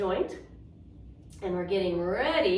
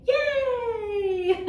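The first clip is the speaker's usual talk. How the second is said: cheer; 13 semitones higher, 3 dB louder than usual. Real, not acted.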